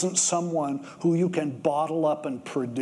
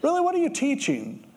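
higher, incredulous challenge; hard on him; 7 semitones higher than usual. Retold or imitated. Jesus scolding Nicodemus for not knowing, incredulous. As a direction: press him like a let-down teacher. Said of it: "Jesus is kinda hard on him".

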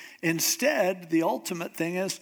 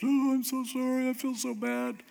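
mock groveling; 8 semitones higher than usual. Preacher mocks listeners who dodged blame, in a high, overdone fake apology. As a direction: ham up a put-on apology. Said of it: "gotten away with stuff by just".

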